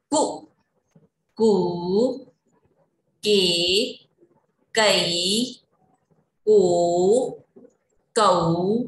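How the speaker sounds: slow paced teacher chant, each syllable set apart; syllable drill recitation. Shown as a demonstration. Teacher leading children through the क row, slow recitation. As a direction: recite the vowel chart for little kids.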